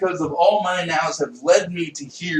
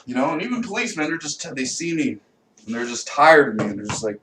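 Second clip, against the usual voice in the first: usual speaking voice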